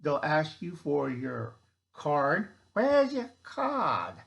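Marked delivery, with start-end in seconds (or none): 2.7-4.1 high, slow, loud mock German official; bad German accent. Official demanding a ration card, in a bad German accent. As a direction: drag it out like a cartoon checkpoint guard. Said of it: "a really bad German impression".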